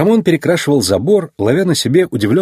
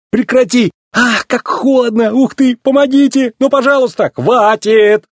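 very high, strained cries; panicked pleading; 12 semitones higher, 4 dB louder than usual. Acted. Racer begs the fire truck under icy water, panicked. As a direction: beg frantically for it to stop. Doused with cold water, begging.

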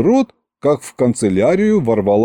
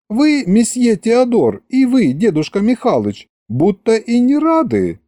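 put-on puzzled, pouting girl; 11 semitones higher than usual. A narrator's reading. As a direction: address each man in hurt surprise, high. Puzzled Masha reproaches both men.

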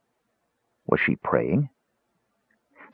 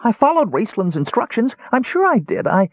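high, pleading kender voice; a touch of panicked kender; distraught kender; 13 semitones higher than usual. A narrator's reading. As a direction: protest tearfully, high. Tass distraught.

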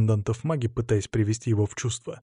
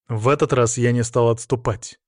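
usual speaking voice

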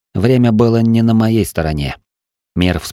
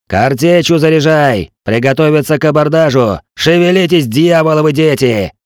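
high-pitched captain's battle commands; shouted orders; 6 semitones higher, 3 dB louder than usual. A narrator's reading. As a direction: drive the crew on before boarding. Captain orders crew before boarding.

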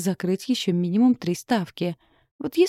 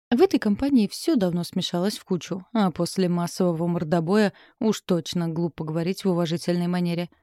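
plain reading voice

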